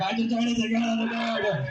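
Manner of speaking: fast, high-pitched shout over the noise; shouted repeat. Acted. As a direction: shout it again in a rush. Repeats it louder over the crowd.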